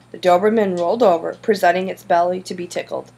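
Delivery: ordinary delivery, nothing marked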